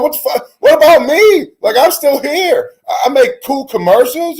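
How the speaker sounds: a hammed-up whine from a benched QB; whiny Baker. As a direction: play a sulking benched quarterback. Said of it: "Baker sitting at home going".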